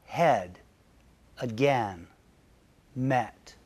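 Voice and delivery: "Head", "again", "met" each spoken slowly alone with a short eh sound, pitch low; vowel demo. Shown as a demonstration. Teacher modeling the short e in "Head", "again", "met", careful and slow. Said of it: "Vowel number four is E".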